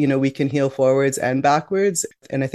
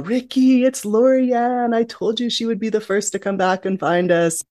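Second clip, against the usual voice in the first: excited call; 8 semitones higher than usual. Retold or imitated. Mother calls out to uncle.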